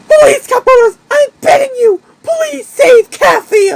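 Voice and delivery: high, crying plea; frantic hamster on the phone to the Cup Brothers; begging hamster. Acted. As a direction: beg desperately for help. Wacky begs on the phone.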